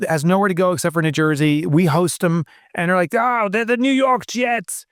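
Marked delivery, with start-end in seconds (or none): 3.1-4.9 high, drawn-out mock fan voice; mock New Yorker. Mimicking New York fans claiming their team, high mocking voice. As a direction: stretch it out high, imitating a boastful New Yorker.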